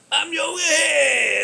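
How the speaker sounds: high, stretched-out show intro; stretched announcer-style name call; drawn-out intro holler. Acted.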